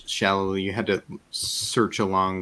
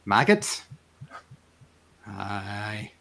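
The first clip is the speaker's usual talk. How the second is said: puzzled Marish fisherman; Marish accent; much slower than usual. Acted. Fisherman asked about a local farmer, Marish accent. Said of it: "the fishermen here have a Marish, uh, accent".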